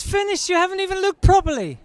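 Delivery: high, outraged wife; high-pitched indignant retort; mocking the ex's shrill complaint. Ex complaining to doctor, quoted.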